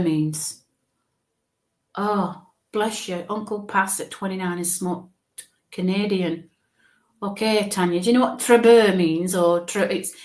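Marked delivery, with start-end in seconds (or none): none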